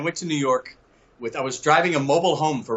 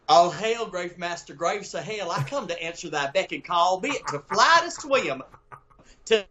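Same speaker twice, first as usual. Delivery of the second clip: put-on Shakespeare verse in a thick Mississippi Southern accent, pitched up; grand theatrical verse in a Southern drawl accent; 5 semitones higher, slower than usual. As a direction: declaim Ariel like a Southern boy from Hattiesburg. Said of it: "Your accent".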